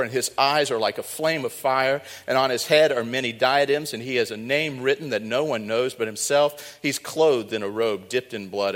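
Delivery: loud, ringing scripture read. Acted. Preacher reading Revelation nineteen aloud, proclaiming. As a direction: proclaim it to the whole church.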